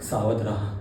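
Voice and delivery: low, soft caution; quiet, deep, ominous stage warning; hushed warning. Acted. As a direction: warn them under your voice.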